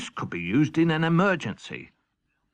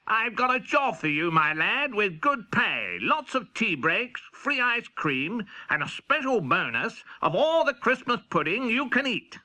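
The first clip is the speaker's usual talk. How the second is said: a touch of a higher kingly voice; lightly voiced king; 8 semitones higher than usual. King offers Henry's cat a job, lightly kingly. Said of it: "when the king said".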